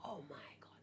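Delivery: breathless whispered awe; awed whisper. Real, not acted. Streamer stunned by a theory, whispering in awe. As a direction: hush it out, stunned.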